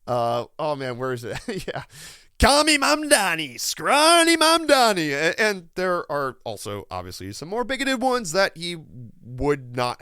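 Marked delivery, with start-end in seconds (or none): 2.4-5.1 higher, louder taunting nickname; mock Trump nickname. Reading out a proposed Trump nickname as a higher, louder taunt. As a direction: sneer it like a playground taunt. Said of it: "a Twitter user that had a whole list".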